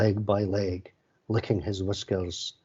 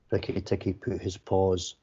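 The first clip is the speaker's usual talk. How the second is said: ordinary delivery, nothing marked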